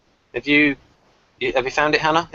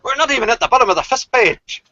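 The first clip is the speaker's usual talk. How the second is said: mock complaint; 9 semitones higher than usual. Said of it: "Where we'll go".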